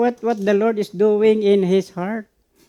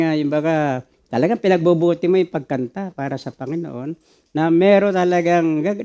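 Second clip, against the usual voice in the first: ordinary talk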